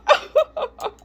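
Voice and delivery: high-pitched mocking cackle; shrill girlish laugh of ridicule. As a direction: laugh at them in a high squeal. Girl laughing at alien food.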